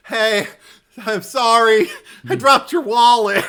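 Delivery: very high, loud, sing-out cheeriness; cheery imitation. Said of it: "I like her cheeriness".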